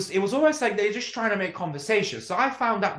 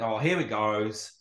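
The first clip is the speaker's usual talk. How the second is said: weary inner groan; 6 semitones lower, slower than usual. Retold or imitated. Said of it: "I was just thinking".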